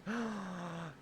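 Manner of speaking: sharp delighted gasp; excited gasp. Real, not acted. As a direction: gasp at the reward. Reward unlocked.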